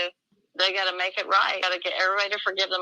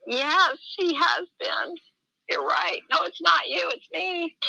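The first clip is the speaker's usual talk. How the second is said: much higher, wavering retold answer; tearful reply; 11 semitones higher, slower than usual. Telling the man the Lord has chastened her, choked up. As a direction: answer him near tears.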